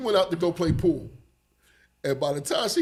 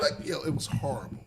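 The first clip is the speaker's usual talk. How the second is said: usual speaking voice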